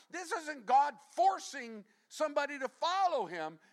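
high, loud impassioned preaching; preaching at full force. Acted. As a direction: drive it home with fire.

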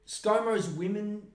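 ordinary talk

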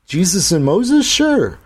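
put-on eager kid answering; boy voice. A narrator's reading. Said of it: "Jake answers in story".

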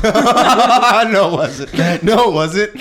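laughing. Real, not acted. Friend denies story, laughing.

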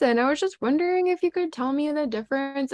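high, bright-eyed mock innocent asking; naive customer voice. To gruff butchers, asking sweetly in a high mock naive voice.

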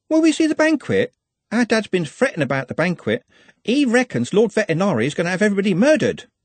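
put-on high, breathless girlish chatter; young girl Juliet. Juliet pours out her reasons to Glenda, high and eager. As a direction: run on like an earnest, eager girl.

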